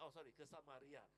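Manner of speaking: normal talking voice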